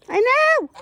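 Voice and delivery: high, loud squeak; mock goose voice. Woman voicing her goose's reply, high squeak.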